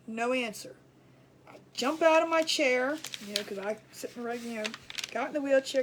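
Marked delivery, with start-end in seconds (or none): none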